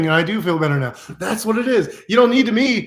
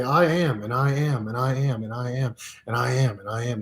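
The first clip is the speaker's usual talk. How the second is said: I am meditation; 4 semitones lower, 6 dB quieter than usual. Shown as a demonstration. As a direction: let each I am sink quieter and deeper. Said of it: "How do I do the I am meditation".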